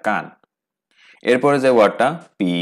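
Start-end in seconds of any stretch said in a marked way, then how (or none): none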